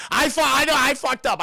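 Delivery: high, loud, stammering admission; flustered panic. Retold or imitated. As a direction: blurt it out in mock panic. Leonard panics after upsetting Penny.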